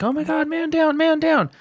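high-pitched imitation of panicking onlookers; mock freak-out. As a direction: squeal it like a crowd freaking out, without extra volume. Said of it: "all these people freaking out".

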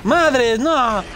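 high, loud cry of fright; startled shout. Real, not acted. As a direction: yell as it lands on you. Enemy leaps straight at player. (grito de susto, agudo y fuerte)

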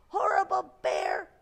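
put-on high, loud outraged little girl; storybook child yelling in outrage; angry girl's shout. A narrator's reading. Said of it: "shouted the girl".

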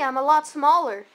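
normal talking voice

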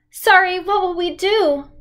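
put-on high, tearful young girl's sob; a lost girl sobbing to a stranger. A narrator's reading. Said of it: "Kristen sobbed".

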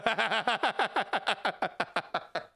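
rapid staccato cackle trailing out; helpless run of laughter; long real laugh. Real, not acted. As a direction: crack up and keep going. Cracks up at own suggestion.

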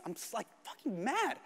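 normal talking voice

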